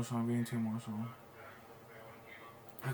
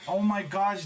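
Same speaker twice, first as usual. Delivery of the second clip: excited oh my gosh; 4 semitones higher, 7 dB louder than usual. Real, not acted. Reacting to winning trade exit.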